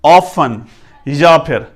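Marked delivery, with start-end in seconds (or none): 0.0-0.6 often as "off-fun", t silent; British pronunciation. Shown as a demonstration. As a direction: say often with "fun" as second syllable. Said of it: "फन".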